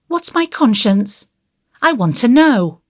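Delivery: put-on high, fast anxious little boy; a worried child, rushing; small boy voice. A narrator's reading. Davy voiced in story.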